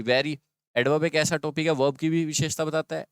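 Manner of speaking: usual speaking voice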